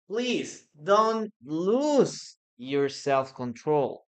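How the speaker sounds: each word drawn out slowly for learners; slow, careful teacher demo; slow model sentence. Shown as a demonstration. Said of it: "one sentence that you can use in this case is".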